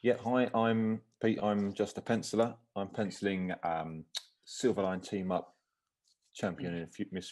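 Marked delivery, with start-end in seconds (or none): none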